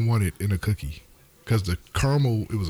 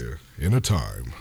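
deep, slow trailer narrator; movie trailer voice; 7 semitones lower, slower than usual. Acted. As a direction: announce it like an epic film trailer. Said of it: "It's my movie voice".

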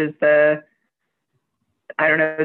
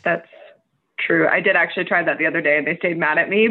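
ordinary delivery

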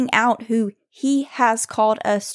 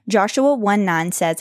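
ordinary talk, nothing marked

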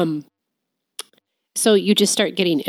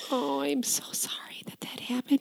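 whisper; 10 dB quieter than usual. Real, not acted. Hearing of childhood abuse.

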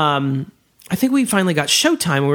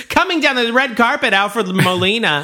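gushing red carpet chatter; 7 semitones higher than usual. Pretend. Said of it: "the least I could have done was an English accent".

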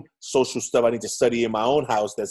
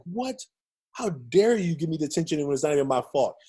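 high, outraged "What?"; mock outrage at getting detention; indignant; 6 semitones higher than usual. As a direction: protest the unfair punishment.

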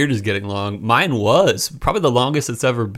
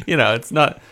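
normal talking voice

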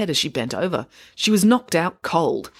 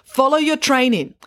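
raised, higher call; 9 semitones higher, 4 dB louder than usual. A narrator's reading. Manager to her staff behind the counter, calling out. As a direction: call an instruction across the room. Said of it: "She called out to her staff".

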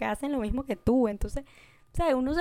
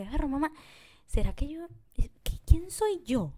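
higher, whispered self-doubt; mock existential whisper; 5 semitones higher than usual. Telling her mom about her identity doubts, whispered. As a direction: breathe it out like a crisis. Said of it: "yo así como que".

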